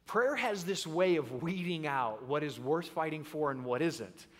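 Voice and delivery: ordinary talk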